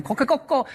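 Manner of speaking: high crow, coquecoco; Japanese rooster crow. Retold or imitated. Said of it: "cacarea en japonés". (canto de gallo japonés agudo, coquecoco)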